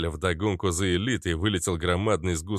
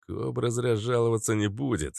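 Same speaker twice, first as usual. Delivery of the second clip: ordinary delivery, nothing marked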